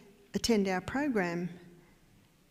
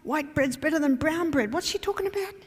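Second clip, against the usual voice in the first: higher, louder mock outrage at the claim; a caller phoning the husband, higher and louder in disbelief; incredulous caller; 7 semitones higher, 4 dB louder than usual. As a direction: voice the baffled caller, pitch climbing up. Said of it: "and she says".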